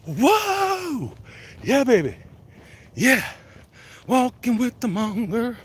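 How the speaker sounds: high, loud mock rock whoop; over-the-top excited holler; hammed-up hype. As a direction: whoop it up like a wild fan. Hyping a song title, hammed up.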